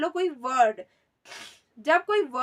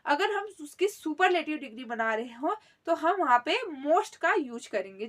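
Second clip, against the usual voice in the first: ordinary talk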